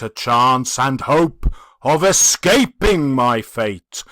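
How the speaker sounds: loud, heavy spectral declaration; a dead man's bitter lesson; passionate ghost. Acted. The ghost speaks.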